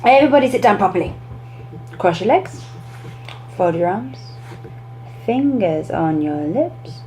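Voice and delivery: teacher calming the class down; teacher commands. Shown as a demonstration. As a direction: settle the class step by step. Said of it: "teacher commands".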